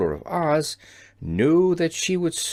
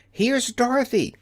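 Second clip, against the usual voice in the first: shouting; 9 semitones higher than usual. A narrator's reading. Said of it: "she shouted as loudly as she could".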